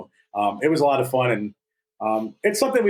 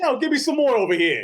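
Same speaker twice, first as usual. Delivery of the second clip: put-on high, brash Jersey accent; New Jersey tough-guy accent; 10 semitones higher than usual. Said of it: "in a Jersey accent".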